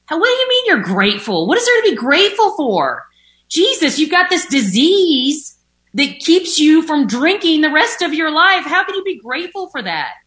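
mock outrage at the idea of gratitude; incredulous scoffing. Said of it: "I remember thinking".

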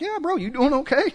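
high, breezy fake-casual bro tone; a put-on easy reply pitched high; mock awkward reply. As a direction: toss the question back like nothing's wrong. Mimicking his own awkward reply.

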